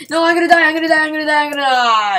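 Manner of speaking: high, loud repeated cry fading at the end; panicked wail. Real, not acted. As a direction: wail that you're about to die. Dying in PVP fight.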